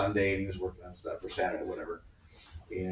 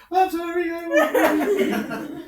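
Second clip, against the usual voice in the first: put-on very high, loud kid squeal; hammed-up frantic pleading; panicked apology; 7 dB louder, much slower than usual. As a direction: beg before the punishment even lands. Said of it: "would be like this".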